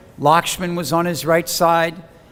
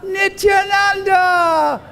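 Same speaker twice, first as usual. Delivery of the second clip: high, drawn-out calling of the name; tearful devotee calling out Nityananda, high and long; slower than usual. Retold or imitated. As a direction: call the name across a distance, stretching it. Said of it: "he called out".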